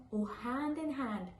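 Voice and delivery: ordinary delivery, nothing marked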